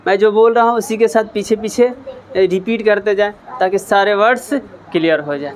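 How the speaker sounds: ordinary talk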